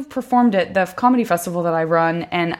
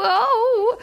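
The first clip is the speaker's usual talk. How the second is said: put-on old lady whimper. Cat owner voicing old cat.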